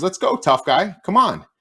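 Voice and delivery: high and loud mocking dare; taunting dare. Ref daring player to fight, loud high taunt. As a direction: egg him on, high and loud. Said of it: "and he goes".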